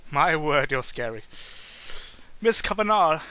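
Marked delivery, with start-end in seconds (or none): 2.4-3.2 receptionist voice. Acted. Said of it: "that's her voice".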